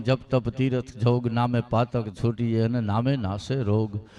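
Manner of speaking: low, slow couplet recitation; recited couplet. Acted. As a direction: chant the verse low and slow. (નીચા, ધીમા સ્વરે દોહો)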